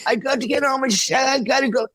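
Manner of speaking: put-on high, shouted drunken rant. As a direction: bellow like a drunk drummer hunting his jacket. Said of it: "I'm not doing it that great".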